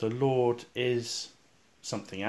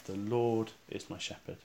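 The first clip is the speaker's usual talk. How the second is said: "Lord" held, then a softer tail; stress on The Lord; 7 dB quieter than usual. Shown as a demonstration. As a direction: stretch "Lord" and drop the rest. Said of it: "with the emphasis on the words".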